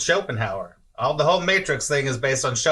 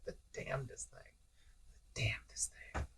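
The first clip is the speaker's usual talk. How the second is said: breathy hushed whisper; 2 semitones higher, 20 dB quieter, faster than usual. Real, not acted. Teacher musing to her students in a whisper. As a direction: drop to a whisper to savour it.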